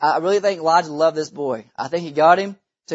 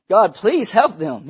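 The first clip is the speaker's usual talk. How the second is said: usual speaking voice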